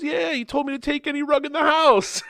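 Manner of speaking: very high, singsong retelling; hammed-up carefree answer; breezy movie quote. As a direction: pitch it way up as a blithe fib. Voices dude's breezy lie to assistant.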